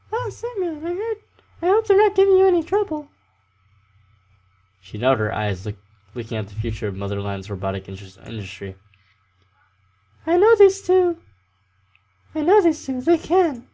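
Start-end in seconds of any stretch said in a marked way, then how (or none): 0.1-3.1 put-on very high girl voice; Slavia voice. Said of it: "saw Slavia". 10.2-13.7 put-on very high girl voice; Slavia voice. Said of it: "saw Slavia".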